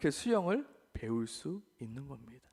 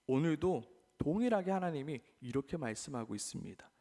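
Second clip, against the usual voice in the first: ordinary talk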